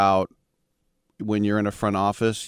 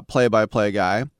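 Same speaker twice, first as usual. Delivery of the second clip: ordinary delivery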